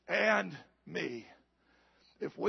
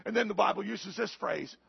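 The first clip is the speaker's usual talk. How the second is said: ordinary talk, nothing marked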